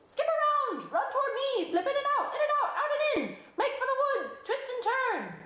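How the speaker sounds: high, loud goose barking commands; goose calling orders. A narrator's reading. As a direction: shout the orders like a bossy goose. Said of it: "cried the goose".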